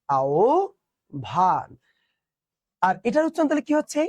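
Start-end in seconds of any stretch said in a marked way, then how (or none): 0.1-1.7 আও ভান in two low, separate parts; correct pronunciation demo. Shown as a demonstration. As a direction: model "ao", pause, "bhan" for learners. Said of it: "এটার উচ্চারণ কি?". (শুদ্ধ উচ্চারণে থেমে থেমে আও-ভান)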